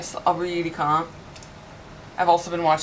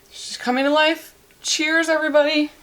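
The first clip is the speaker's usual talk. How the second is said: higher, louder happy cheer; excited toast; 9 semitones higher, 4 dB louder than usual. Real, not acted. Toasting viewers over her finished margarita, excited. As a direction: raise the glass and cheer.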